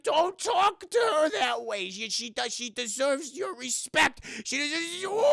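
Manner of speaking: high, slow indignant cartoon scolding; Whitey. Whitey defending Jennifer from Davey, indignant high voice. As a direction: squawk in outrage, dragging the words out high. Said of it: "And he's like".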